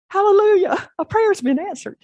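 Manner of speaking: soaring hallelujah; joyful hallelujah. Real, not acted. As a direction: shout it out like a revival cry. Preacher rejoicing at answered prayer.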